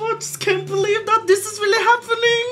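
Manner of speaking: high, loud, near-tearful gushing; overjoyed squealing. Real, not acted. Fan watching the couple, squealing with happy tears. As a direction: gush like an overwhelmed fan. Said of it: "I'm so excited now".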